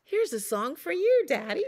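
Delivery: an eager pup calling his dad; Little Wolf. Acted. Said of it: "Then he said".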